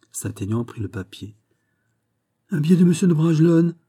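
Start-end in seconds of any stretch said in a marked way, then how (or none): 2.5-3.8 loud, high cry of discovery; startled exclamation. A narrator's reading. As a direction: exclaim it in astonishment, pitched up. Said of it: "s'écria-t-il". (exclamation de surprise, haute et forte)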